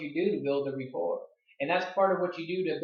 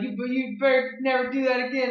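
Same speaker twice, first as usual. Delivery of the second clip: stern scolding; 8 semitones higher, 4 dB louder than usual. Retold or imitated. Dad scolds son after jump.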